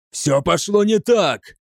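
high, slow, angry outburst; narrator's restrained roar. A narrator's reading. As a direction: roar it out in frustration. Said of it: "взревел Грамматикус".

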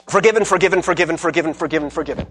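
rapid-fire, even repeated dispensing; offhand rattling off. Acted. Imagined Jesus forgiving everyone casually, offhand and rattled off. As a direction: hand them out like stamping papers.

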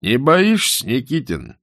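выше и громче, с вызовом; поддень бывшего городового; подначка. A narrator's reading. (матрос поддразнивает Никитина, задиристо)

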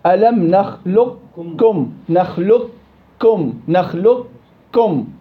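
نخلقكم recited very slowly with the qaf merged into kaf; full assimilation. Shown as a demonstration. Teacher slowly recites نخلقكم to show full merging. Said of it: "في قوله تعالى".

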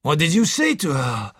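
a little winded question; slightly out of breath. A narrator's reading. Doctor catches up with detective, slightly breathless. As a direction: ask it slightly puffed. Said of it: "a little out of breath".

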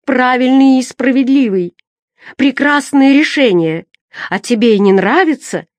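high, loud outburst; crying out. A narrator's reading. Madwoman defending the verdict to the boy, shouting. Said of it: "закричала косматая".

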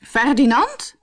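loud, high and annoyed; cross queen. A narrator's reading. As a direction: call his name like an exasperated wife. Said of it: "heel boos".

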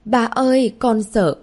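put-on high, loud tearful child; a scared girl clinging to her father; frightened little girl. A narrator's reading. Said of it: "rồi òa khóc". (bé gái sợ hãi mếu máo)

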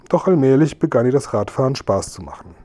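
plain reading voice

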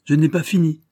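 commanding duke silencing his son; authoritative. A narrator's reading. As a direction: cut him off with fatherly authority. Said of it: "prit un air d'autorité".

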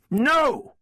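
high, forceful cry; high-pitched, defiant outburst; furious refusal. A narrator's reading. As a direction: slam the door on his plea with a high shout. Cuts off brother's plea in quarrel.

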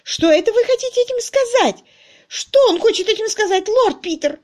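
very high, loud, frantic lady; shrieking. A narrator's reading. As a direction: squeal the demand in outrage. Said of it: "взвизгнула мисс Твиттертон".

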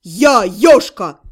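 loud, low, slow declaration; demon's bark. A narrator's reading. Demon announcing who he is, low loud bark. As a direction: boom out the name, low and drawn out. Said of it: "рявкнул демон".

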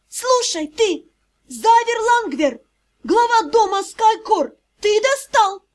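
high, furious tirade; angry yelling. A narrator's reading. Vampire daughter tearing into her father, angry yelling. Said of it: "орала вампирша".

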